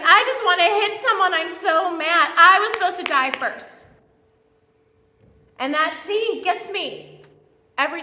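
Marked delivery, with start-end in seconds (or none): 0.0-3.9 loud, high grieving outburst; hammed-up anguished, furious cry; mock sobbing rage. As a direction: imitate a bereaved mother raging. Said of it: "And she is sobbing. She's like".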